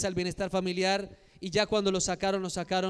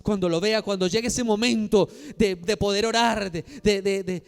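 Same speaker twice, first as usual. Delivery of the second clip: urgent acted self-questioning; 2 semitones higher, 5 dB louder than usual. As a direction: rehearse frantically, growing more insistent. Acts out anxious planning before prayer. (pregunta ensayada, cada vez más apremiante)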